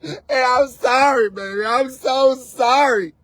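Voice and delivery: normal talking voice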